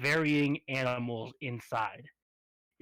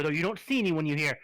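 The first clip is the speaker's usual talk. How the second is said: ordinary talk, nothing marked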